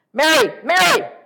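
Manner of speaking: high, piercing shout of a name; yelling. Acted. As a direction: yell the name twice, very loud. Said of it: "she was yelling".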